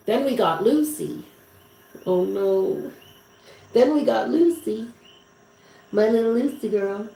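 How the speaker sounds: high, tender pet-name voice; doting coo. Real, not acted. Remembering little Lucy girl, high doting coo. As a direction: coo fondly over a little dog.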